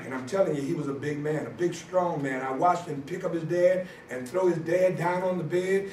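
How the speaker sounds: ordinary talk, nothing marked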